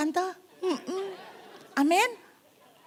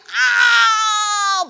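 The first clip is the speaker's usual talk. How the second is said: loud, high cry of pain; pained yell; 12 semitones higher, 11 dB louder than usual. Retold or imitated. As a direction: yelp as if hit hard. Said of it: "Wanita ini teriak". (teriakan kesakitan)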